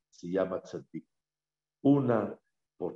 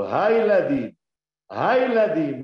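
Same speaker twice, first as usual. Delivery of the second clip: put-on higher, louder declaration of his children; emphatic proclamation; 7 semitones higher, 8 dB louder, slower than usual. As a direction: announce them proudly. Jacob answering Esav emphatically.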